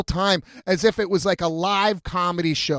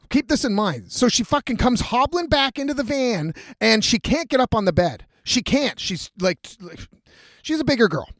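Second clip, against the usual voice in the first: ordinary delivery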